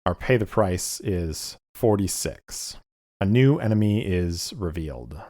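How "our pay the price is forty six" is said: normal talking voice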